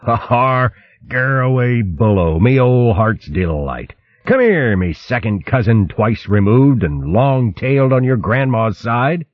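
hearty, booming welcome from a jolly otter queen; rowdy otter queen hailing a long-lost cousin; boisterous otter queen. Acted. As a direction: throw your arms wide and roar a greeting. Otter queen greets old friends.

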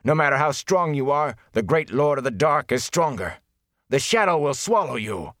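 high-pitched fanatical threat; angry spitting. A narrator's reading. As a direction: hurl an angry prophecy of doom. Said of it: "he spat angrily".

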